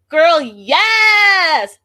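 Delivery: high, loud shout of approval; cheering shout. Retold or imitated. Cheering on Chantel from the couch, loud shout. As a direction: yell it like hyping up a friend. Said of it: "this is when I was like".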